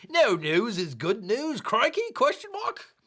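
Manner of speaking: hammed-up Aussie with rising, high question endings; bad Australian accent. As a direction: read it like a cartoon Australian. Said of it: "I gotta do this in the accent".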